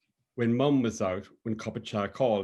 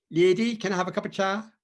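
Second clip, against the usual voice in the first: put-on higher, louder, sing-song Cork accent; Dan's Cork lilt; 9 semitones higher, 5 dB louder, faster than usual. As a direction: lilt the request like a Cork man asking for tea. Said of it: "in his lilting Cork tone".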